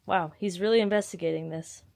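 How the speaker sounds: ordinary talk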